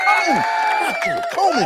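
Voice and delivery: a high, startled cry of disbelief; shocked surprise. Real, not acted. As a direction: jump up in pitch at the name. Reacts to shocking donation.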